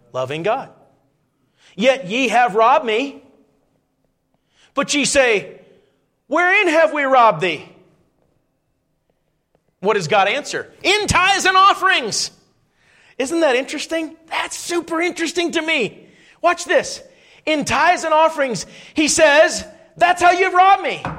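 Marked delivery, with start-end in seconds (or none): 1.8-7.7 high, loud, slow scripture call; fervent preaching; preaching at full force. Real, not acted. 10.8-21.0 high, loud, slow scripture call; fervent preaching; preaching at full force. Real, not acted.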